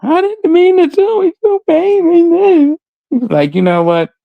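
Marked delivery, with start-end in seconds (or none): 0.0-2.8 high, whiny fake innocence, drawn out; mock whiny victim. Mimicking a manipulative friend, high whiny pleading. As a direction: play the helpless victim, pleading.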